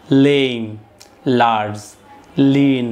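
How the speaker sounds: each English adjective said alone, slow and clear, with a falling end; careful word pronunciation. Shown as a demonstration. Teacher reading vocabulary list aloud to students, careful model pronunciation. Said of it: "শুদ্ধভাবে উচ্চারণ করছি".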